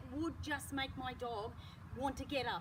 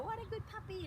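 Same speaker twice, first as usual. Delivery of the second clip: a hint of high puppy praise; lightly voiced excited owner; 8 semitones higher, 3 dB quieter than usual. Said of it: "go back to her and go".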